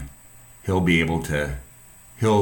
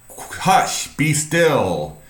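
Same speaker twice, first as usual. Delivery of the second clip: commanding rebuke; 8 semitones higher, much slower than usual. Retold or imitated. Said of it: "he yells at the waves".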